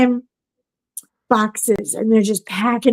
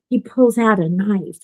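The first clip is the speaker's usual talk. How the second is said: ordinary talk, nothing marked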